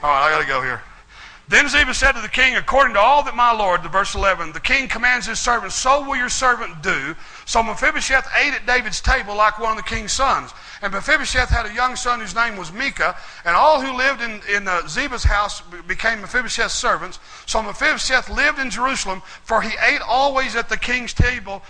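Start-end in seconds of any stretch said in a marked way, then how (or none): 1.5-3.8 loud, raised preaching read; scripture reading. Acted. Pastor reading Samuel aloud to the church, loud proclaiming read. 4.5-21.6 loud, raised preaching read; scripture reading. Acted. Pastor reading Samuel aloud to the church, loud proclaiming read.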